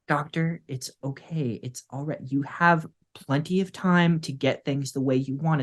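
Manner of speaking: quiet, calming reassurance; soothing. Acted. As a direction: gently talk the doctor down. Assistant soothing the upset doctor.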